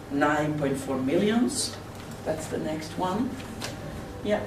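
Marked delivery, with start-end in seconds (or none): none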